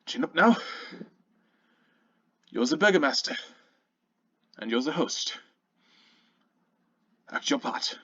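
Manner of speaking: steel yourself, then drop to a murmur; Aldrich giving himself a pep talk. Acted. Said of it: "Aldrich speaks to himself".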